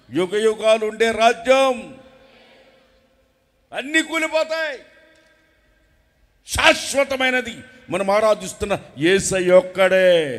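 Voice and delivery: loud, rising sermon shout; full-force preaching. Acted. Preacher shouting to the congregation, fiery and loud.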